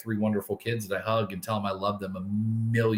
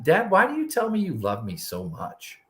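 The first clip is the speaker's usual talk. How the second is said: higher-pitched imitation of a child's question; puzzled daughter; 8 semitones higher than usual. Dad retelling his daughter's question, in a higher puzzled voice. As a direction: ask it high, like a puzzled kid.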